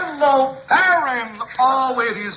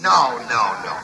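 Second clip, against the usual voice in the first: ordinary talk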